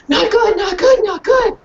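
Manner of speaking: high, loud worried barks; small dog barking alarm. Acted. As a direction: warn like a frightened little dog.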